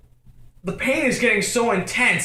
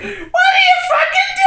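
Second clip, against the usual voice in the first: shrieked, very high, loud mock outrage; hammed-up piercing shriek; mom yelling; 7 dB louder than usual. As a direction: screech it like an alarmed mom. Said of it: "she's yelling at me".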